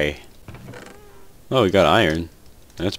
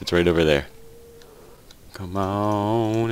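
ordinary delivery

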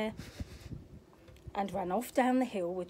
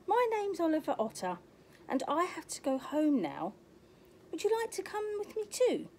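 Oliver Otter; 8 semitones higher than usual. Acted.

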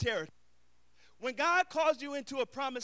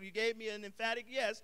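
ordinary talk, nothing marked

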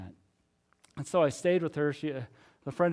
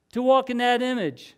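high, loud, impassioned sermon delivery; full-force preaching; 7 semitones higher, 9 dB louder than usual. Acted. Pastor urging the congregation to love themselves, preaching at full force. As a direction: preach it out to the whole church.

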